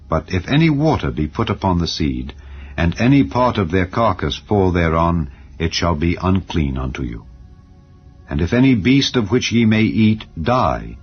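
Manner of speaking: ordinary delivery, nothing marked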